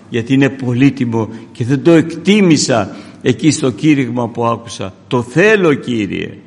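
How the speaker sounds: impassioned kneeling prayer, reenacted; retold fervent prayer. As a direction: cry out to God on your knees, retelling it. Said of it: "Και γονατίζω και λέω".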